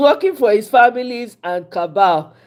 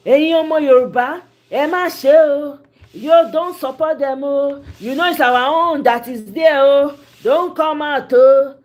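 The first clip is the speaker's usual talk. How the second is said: mock Yoruba appeal; 5 semitones higher, 4 dB louder than usual. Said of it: "you see on Twitter".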